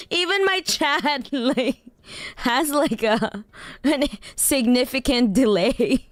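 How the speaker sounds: high-pitched words broken by real laughter; can't stop laughing while explaining. Real, not acted. Streamer laughing helplessly at the chat's delay.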